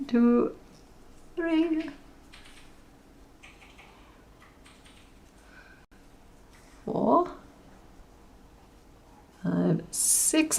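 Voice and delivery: counting under her breath; hushed stitch count; quiet counting. Real, not acted. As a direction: murmur the numbers to yourself while working.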